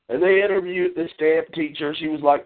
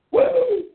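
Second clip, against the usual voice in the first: boastful yelp of triumph; triumphant whoop. Real, not acted. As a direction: let out a victory whoop. Bragging whoop.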